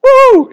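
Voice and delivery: high, loud comic whoop; sudden high-pitched playful whoop; mock whooping "woo". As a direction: let out a silly spell-casting "woo!". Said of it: "he doesn't say, he goes".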